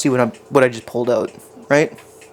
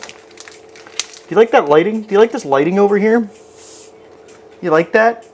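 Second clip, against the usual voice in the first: mock proud showing off; 7 semitones higher than usual. Asking viewers about his lighting, teasing and high.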